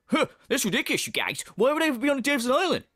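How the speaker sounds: hammed-up indignant streamer; Phil impression. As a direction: imitate a streamer scoffing at a silly question. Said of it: "And he's like".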